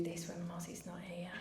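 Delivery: giggling whisper through the words; whispered laugh. Real, not acted. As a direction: laugh it out under the breath. Laughing aside about the impression.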